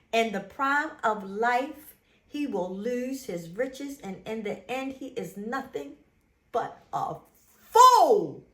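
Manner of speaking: starts level, ends in a loud cry on fool; building to a shout. Real, not acted. Vlogger reading Proverbs to her viewers, swelling into a shout. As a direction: read it calmer and calmer, then explode on fool. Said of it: "reads verse building to shout".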